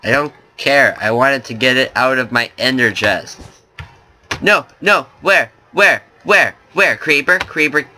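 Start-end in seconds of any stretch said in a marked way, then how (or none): 4.4-7.9 sudden alarm at a creeper, fast and frantic; panicked. Real, not acted. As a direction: player spotting a creeper, panicked calling.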